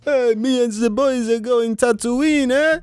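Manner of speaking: alien voice. Pretend. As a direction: boast like a Star Wars alien.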